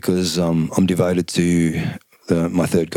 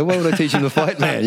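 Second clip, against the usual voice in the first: much higher, laughing disbelief; incredulous; 9 semitones higher than usual. Retold or imitated. Answering his mates about teaching his boy to fight, incredulous. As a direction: scoff at the whole idea. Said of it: "I go".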